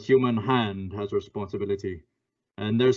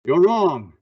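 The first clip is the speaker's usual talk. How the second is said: higher, louder retort; forceful accusing cry; sharp shout; 7 semitones higher, 8 dB louder than usual. Acted. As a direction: cut him off hard. Shadow confronts suspect.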